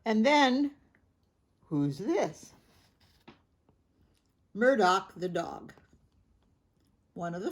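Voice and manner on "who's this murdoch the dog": plain reading voice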